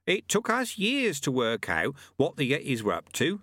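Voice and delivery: high-pitched master's voice; explaining old monk. Acted. Old monk explaining yetis to his student, knowingly. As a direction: pitch it up as a sage old teacher. Lu-Tze's held voice.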